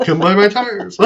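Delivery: lightly voiced wobbly sales gimmick; giggly, hammed-up tire pitch; a hint of loopy pirate voice, laughing; inflatable flailing arm man. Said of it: "I do the exact same impression".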